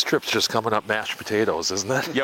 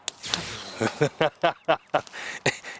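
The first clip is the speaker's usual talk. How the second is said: long chuckle; 3 semitones higher, 3 dB quieter than usual. Real, not acted. Laughs at own joke.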